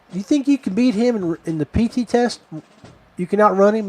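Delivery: high-pitched challenging questions; imitated drill sergeant. Head drill sergeant challenges recruit to beat his trainer, imitated high-pitched. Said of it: "He's like".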